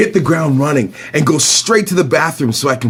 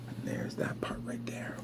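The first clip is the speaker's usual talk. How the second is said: breathy whispered aside; 24 dB quieter than usual. Real, not acted. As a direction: whisper it to the side.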